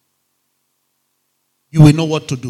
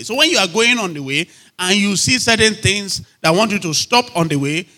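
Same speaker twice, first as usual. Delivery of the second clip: normal talking voice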